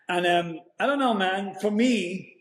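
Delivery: ordinary delivery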